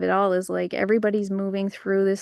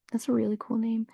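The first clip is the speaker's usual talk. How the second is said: soft whispered compliment; 6 dB quieter than usual. Real, not acted. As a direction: whisper it kindly.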